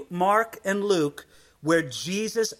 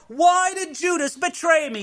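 high pretend outcry, voice shifted; mock cry; 9 semitones higher than usual. Preacher posing a cry Jesus never made, high and raised. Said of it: "does he cry out".

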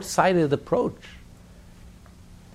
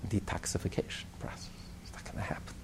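whisper; 8 semitones lower, 15 dB quieter than usual. Real, not acted. Rabbi telling listeners detox must hurt, whispered aside. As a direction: drop to a hushed whisper.

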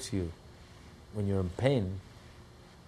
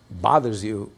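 ordinary delivery

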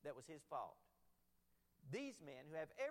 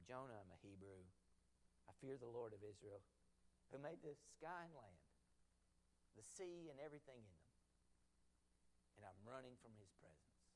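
Jonah confessing; 7 semitones lower, 7 dB quieter than usual. Retold or imitated. Jonah admitting to the sailors he is fleeing, low and soft. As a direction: lower and softer, a subdued confession.

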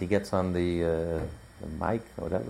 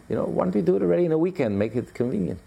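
lightly voiced pleader, pitched up and quick; mock suggestion; 8 semitones higher, faster than usual. Voicing people who want the holiday moved to a weekend, mock pleading. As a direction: plead for the easy option in a higher voice. Mimics people wanting an easier date.

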